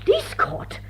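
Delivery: scandalised half-whispered repeat; horrified gasp. Acted. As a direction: gasp the words out in horror. Horrified echo of "police court.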